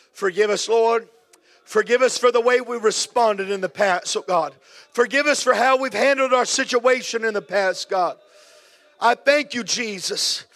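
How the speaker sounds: loud, high pleading prayer; impassioned loud prayer. Acted. Pastor leading the church in repentance, loud and high. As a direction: cry out to God for the whole room.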